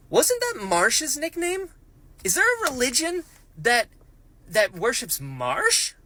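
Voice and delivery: disbelief dawning as she asks; shocked high-pitched wonder; astonished. Real, not acted. Stunned realization questions.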